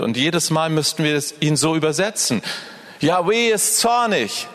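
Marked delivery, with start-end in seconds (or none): 3.0-4.5 mock stern pronouncement. Mocks a false image of God.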